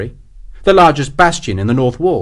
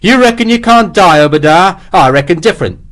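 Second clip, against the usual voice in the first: vengeful shouting; 7 semitones higher, 6 dB louder than usual. Acted. Sharp's final vengeful threat.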